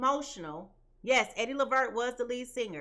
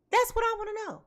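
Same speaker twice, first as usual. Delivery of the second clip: higher, louder demand; 9 semitones higher, 4 dB louder, faster than usual. Real, not acted.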